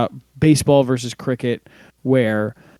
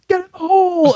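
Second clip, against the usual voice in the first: high, stretched-out spectator holler; golf crowd heckler; 4 dB louder than usual. Retold or imitated. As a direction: imitate a fan yelling from the gallery. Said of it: "somebody in the crowd goes".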